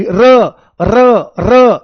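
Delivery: repeated र, each rolled with a vibrating tongue; trilled र. Shown as a demonstration. Teacher demonstrates tongue vibration for the class, repeated trilled र. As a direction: punch out each र with a tongue flutter. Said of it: "ऐसे जीभ हिलती हुई दिखाई देगी".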